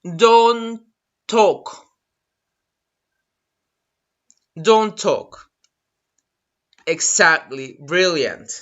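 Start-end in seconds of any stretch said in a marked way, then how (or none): 0.0-5.5 "talk" as "tawk", L silent; spaced teacher's model of "Don't talk"; repeat-after-me model. Shown as a demonstration. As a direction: say "talk" without the L for learners to copy. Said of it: "so repeat after me".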